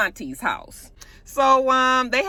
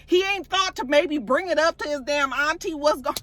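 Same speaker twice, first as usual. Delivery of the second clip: pitched-up exasperated rant at the characters; ranting frustration; 7 semitones higher than usual. Real, not acted. Viewer ranting at Auntie and Kane about the name, frustrated. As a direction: vent at the TV, voice climbing high. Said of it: "I'm more mad".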